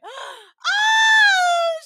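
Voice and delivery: loud, very high wailing "oh"; hammed-up shriek of overjoyed surprise; mock wail. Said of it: "starts, like, wailing".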